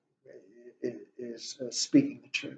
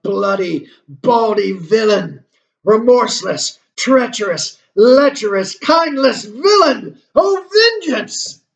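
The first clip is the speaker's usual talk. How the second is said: Hamlet crying out; 8 semitones higher, 12 dB louder than usual. Acted. Lecturer performing Hamlet's cry against Claudius, a soaring furious shout. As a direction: rage until vengeance breaks out of you. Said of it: "he cries out".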